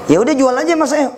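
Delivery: pleading buyer up high; mock plea from an eager buyer. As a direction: beg him to sell, voice up high.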